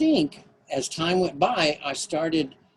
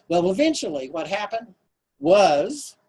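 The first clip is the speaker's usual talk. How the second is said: normal talking voice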